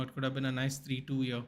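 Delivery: ordinary talk, nothing marked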